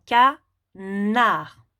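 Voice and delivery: "canard" split in two syllables, ca, nard, with a pause between; découpage en syllabes. Shown as a demonstration. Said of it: "pour chaque syllabe dans le mot". (« canard » détaché en deux syllabes : ca… nard)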